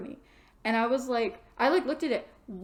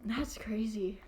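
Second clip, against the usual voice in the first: breathy whispered aside; 2 semitones lower, 8 dB quieter, slower than usual. Real, not acted. Reading a viewer's dream, a whispered reaction. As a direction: whisper it under your breath.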